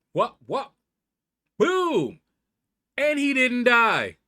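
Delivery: high, loud disbelief building over the fight; excited outburst. Real, not acted. Gamer shouting at his screen in real excitement. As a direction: yell it out as the hit lands. Gaming near-death moment.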